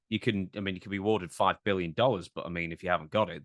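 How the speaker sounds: ordinary delivery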